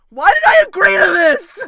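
loud, high wail of regret; high, yelled lament; anguished shout. Real, not acted. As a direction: cry out in exasperated dread. Regrets playing horror game.

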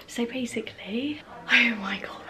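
soft whisper; whispered aside to the camera. Real, not acted. Whispering in Ollie's room.

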